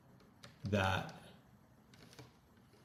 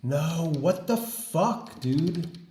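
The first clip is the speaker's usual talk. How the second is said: high, loud shocked yell at the screen; furious outburst; 7 semitones higher, 6 dB louder than usual. Real, not acted. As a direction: shout in disbelief as your work vanishes. Prompt suddenly lost, outburst.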